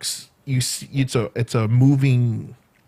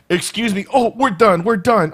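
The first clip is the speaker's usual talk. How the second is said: mock impatient; 6 semitones higher than usual. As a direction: sing out a fed-up excuse me, higher. Pushing past the selfie couple.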